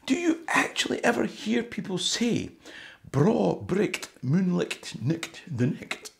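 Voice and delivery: put-on high, posh-sounding English accent quoting Scots; English lassie. English commenter asks about Scots, in a high English accent. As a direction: mimic an English commenter saying Scots words. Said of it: "she said".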